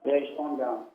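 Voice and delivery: phone line voice; over the phone. Real, not acted. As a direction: caller through a phone.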